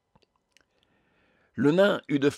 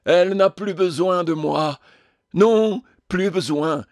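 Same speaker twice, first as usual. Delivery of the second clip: high, loud tragic declamation; hammed-up anguish, slowing on Non; choked theatrical despair; 6 semitones higher, 6 dB louder than usual. A narrator's reading. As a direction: lament to the gallery, voice catching. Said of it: "sa voix s'étranglait dans sa gorge".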